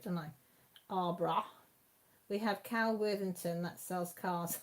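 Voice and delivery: usual speaking voice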